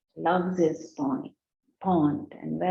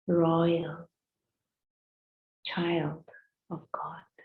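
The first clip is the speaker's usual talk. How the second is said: meditation voice. Acted. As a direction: speak gently, like ending a guided sitting. Closing words of a meditation.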